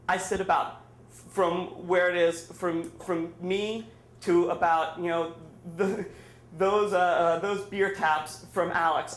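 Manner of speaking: ordinary delivery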